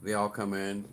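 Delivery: ordinary delivery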